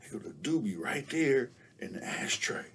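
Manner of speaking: hushed whisper to himself; whispered thought. Retold or imitated. Kid alone in back seat, whispering. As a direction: whisper it so nobody hears. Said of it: "and I'm like".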